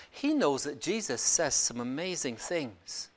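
ordinary talk, nothing marked

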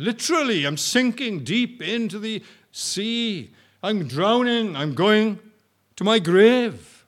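loud, high, slowing drowning plea; preaching at full force. Acted. Preacher voicing the sinking disciple, loud and slowing. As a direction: build it up like a drowning man.

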